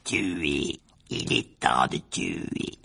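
low, very slow killer's rasping hush; chill, spaced-out menace; cold, deadly voice. A narrator's reading. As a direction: drag each word coldly. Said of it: "la même voix froide et mortelle".

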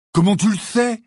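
loud, high, abrupt challenge. A narrator's reading. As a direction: throw the question out sharply. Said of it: "d'un ton abrupt". (ton abrupt, fort et aigu)

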